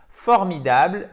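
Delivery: ordinary talk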